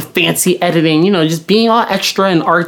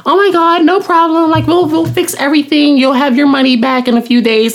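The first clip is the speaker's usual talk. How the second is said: chipper helper; 8 semitones higher than usual. Retold or imitated. Imitating chipper support agent.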